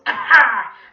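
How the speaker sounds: very loud evil cackle; high, booming villain laugh; scary villain cackle. Acted. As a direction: burst out in a monstrous cackle. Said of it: "was even scarier than his real voice".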